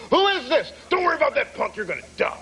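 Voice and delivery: high-pitched, hammed-up panicky outburst; mock freaking out. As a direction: imitate a teacher losing it on the phone. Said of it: "He's, like, freaking out".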